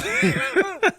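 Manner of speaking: high laugh after exclaiming; amused laugh. Real, not acted. Host laughing at someone missing the news, amused. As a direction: laugh at the very idea.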